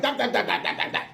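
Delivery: mocking run-on blah-blah chatter; nonstop chattering neighbor. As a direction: imitate a neighbor who won't stop yammering. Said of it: "She wouldn't stop talking".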